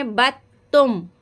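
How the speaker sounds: बत तुम with the dal silent and ba running into ta; merged ba into ta. Shown as a demonstration. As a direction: join ba straight into ta in बत तुम. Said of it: "बा को ता के साथ मिला देंगे". (बा को ता से मिलाकर)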